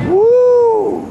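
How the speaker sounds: piercing whoop of a cheer; high loud woo. Real, not acted. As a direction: let out a wild high woo. Wild cheer after boast.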